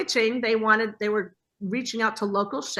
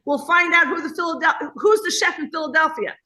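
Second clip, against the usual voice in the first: louder, higher eager pitch; excited husband; 6 semitones higher, 3 dB louder than usual. Retold or imitated. Husband pitching the tailgate rivalry, excited and louder. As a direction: sell the idea with gusto. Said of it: "and Michael's like".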